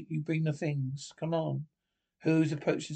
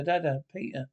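ordinary talk, nothing marked

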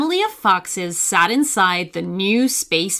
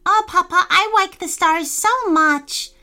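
put-on much higher, babyish little girl voice; 10 semitones higher than usual. A narrator's reading. Baby fox tells papa she loves the stars, high and babyish. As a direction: coo it like a tiny child. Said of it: "said in her gibberish language".

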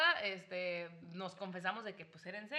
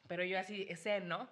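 normal talking voice

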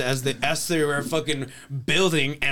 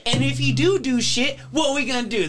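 mock founding fathers; 10 semitones higher than usual. Friends voicing founding fathers, higher defiant bluster. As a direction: ham up the rebels scoffing at the king.

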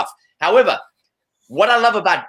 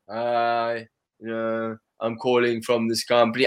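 lower, softer canned sales opener; flat scripted sales-call greeting; scripted cold caller; 7 semitones lower, 5 dB quieter, slower than usual. Retold or imitated. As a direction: mimic a bored telemarketer reading the script. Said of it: "But if I hear".

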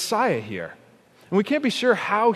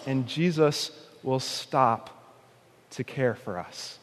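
tender; 5 semitones lower, 6 dB quieter than usual. Real, not acted. Tender closing appeal.

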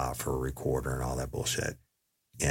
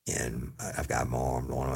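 ordinary talk

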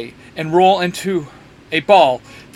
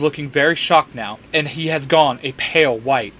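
plain reading voice

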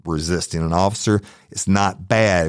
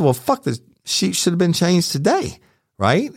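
pitched way up, indignant retort; mock angry retort; 12 semitones higher than usual. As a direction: throw it back at her, outraged and high. Retold quarrel with hotel clerk.

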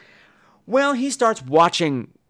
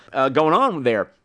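normal talking voice

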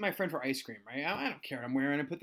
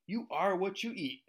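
slow, higher retort; deliberate comeback; 4 semitones higher than usual. Retold or imitated. Teacher answers student's insult in hallway, slow mock-witty retort. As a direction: deliver it slowly, like a big comeback. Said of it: "I got the best comeback".

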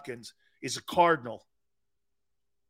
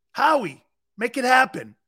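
higher and louder, calling out to Howie; 6 semitones higher, 6 dB louder than usual. Real, not acted. Host calling on the GM Howie: louder, higher plea. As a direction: urge him to make it happen.

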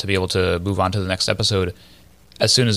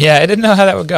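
laughing through the words, high and loud; real laugh after a fake accent; 8 semitones higher, 11 dB louder than usual. Real, not acted. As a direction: crack up at the bit.

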